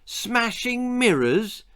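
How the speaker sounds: high, slow, astonished cry; exclaimed. A narrator's reading. Cobbler exclaims at the messenger's odd demand. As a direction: gasp it out in disbelief. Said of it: "exclaimed Mister Pojo".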